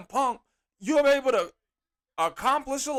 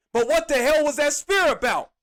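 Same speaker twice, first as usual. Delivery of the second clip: loud, high, exasperated yell; angry shout; 5 semitones higher, 5 dB louder than usual. Real, not acted. Fan venting at the wrestler's bad spear, shouting. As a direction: shout it at the screen in disbelief.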